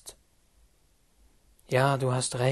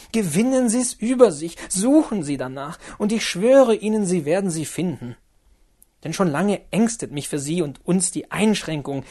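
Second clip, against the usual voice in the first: put-on higher, louder, urgent young woman's plea; Lotte pleading; 4 semitones higher, 5 dB louder than usual. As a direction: beg him to calm down, rising urgency. Lotte urges him to leave.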